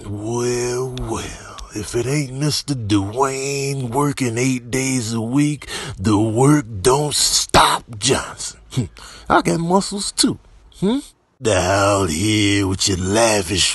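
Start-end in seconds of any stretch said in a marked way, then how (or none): none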